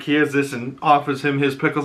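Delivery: normal talking voice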